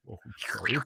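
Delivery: unvoiced whisper of a sorry ghost; whispered apology. Ghost old man whispering to the bedroom sleeper. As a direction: breathe the apology, no voice.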